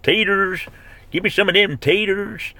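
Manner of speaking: put-on high-pitched Southern boy hollering for food; southern boy accent. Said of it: "I say it like a southern boy".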